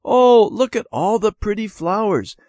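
high, loud delighted exclamation; excited cry. A narrator's reading. Debbie delighted by the park flowers, excited cry. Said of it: "Debbie cried".

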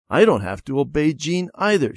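defiant. A narrator's reading. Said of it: "she told herself".

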